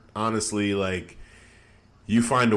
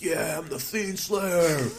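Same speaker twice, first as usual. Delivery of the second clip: higher, slow, smug mock boast; lightly voiced cocky wrestler; mock Seth Rollins brag; 9 semitones higher, slower than usual. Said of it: "just being like".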